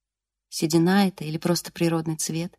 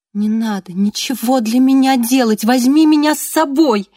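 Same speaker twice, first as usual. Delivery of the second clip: louder, higher desperate plea; desperate cry; 5 semitones higher, 6 dB louder than usual. A narrator's reading. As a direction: cry out on the edge of tears. Desperate plea, near tears.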